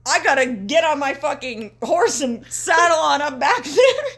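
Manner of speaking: high, slow hammed-up cowboy; exaggerated cowboy voice; cowboy drawl. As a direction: play a cartoon cowpoke rushing off. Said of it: "So Hangman is like".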